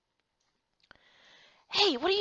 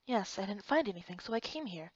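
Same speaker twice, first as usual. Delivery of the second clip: ordinary delivery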